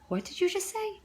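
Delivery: high, disbelieving retold question; stunned disbelief. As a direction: squeak it out in shock. Shocked at husband's offer.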